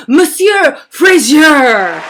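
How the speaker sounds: loud, drawn-out hammed-up French announcement; mock French accent. Host introducing today's guest to applause, hammed-up French accent. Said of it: "my French accent is".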